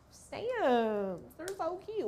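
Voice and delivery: high, soft cutesy squeal; cutesy gushing. Real, not acted. Crafter gushing over her cute little stamps, high cutesy squeal. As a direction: coo over something tiny, voice jumping up high.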